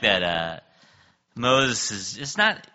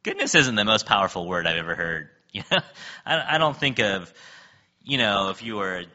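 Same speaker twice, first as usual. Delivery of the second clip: ordinary talk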